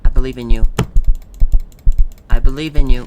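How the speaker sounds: full-voiced rallying shout; loud cheering. Real, not acted. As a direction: yell it to push him on. Cheering on game character.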